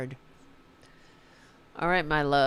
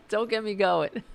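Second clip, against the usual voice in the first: said through a laugh, a bit higher; 4 semitones higher, 5 dB louder than usual. Real, not acted. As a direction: laugh out the words, half amused, half fed up. Laughs while ranting about Republicans.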